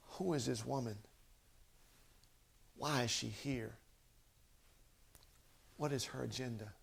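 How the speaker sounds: soft questions with long pauses between; quiet bewilderment. Retold or imitated. Boaz puzzling over the woman, in quiet bewilderment. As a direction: wonder it under your breath, a question at a time. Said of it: "Boaz woke up crazy".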